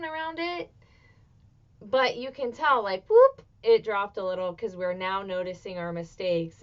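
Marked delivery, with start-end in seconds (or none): 3.1-3.4 mock whoop. Imitating abundance dropping.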